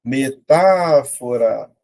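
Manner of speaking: word drawn out slowly, syllable by syllable; slow dictation. Shown as a demonstration. Teacher slowly reading out a quiz word. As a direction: spell it out slowly for the class.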